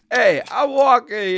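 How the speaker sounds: loud, exaggerated New York accent. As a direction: belt it like a New York cabbie. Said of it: "I'll do New York accent".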